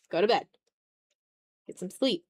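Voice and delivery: ordinary talk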